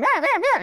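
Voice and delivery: high, whiny imitation of a crying baby; preacher mimicking a crying infant, high and whiny; mock baby whimper. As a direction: whimper like a fussy toddler, pitched high up. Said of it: "the little child is crying".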